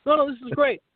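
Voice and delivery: high-pitched, upbeat insistence; acted-out sales enthusiasm; retold eager salesman. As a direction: sell it brightly over their objection. Acting out salon cold-call pitch.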